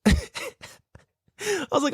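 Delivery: high, slow laugh breaking through. Real, not acted. Telling viewers his plan, laughing high and slow. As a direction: crack up mid-sentence. Laughing hard while retelling.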